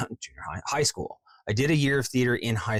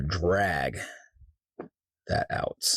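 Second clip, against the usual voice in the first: dragged out; 4 semitones lower, much slower than usual. Real, not acted. Drags the words while working.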